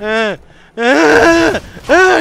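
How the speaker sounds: loud, high yelps building in force; imitated panicked wail, escalating; freak-out cries. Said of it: "we hear him start going".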